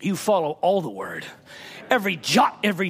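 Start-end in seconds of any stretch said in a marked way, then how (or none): none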